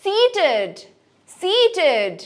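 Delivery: high, falling সিটেড with -id; -id ending. Shown as a demonstration. Teacher models past tense, সিটেড ending -id. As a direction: stretch the first part of "সিটেড", light -id. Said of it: "আমরা কি বলবো".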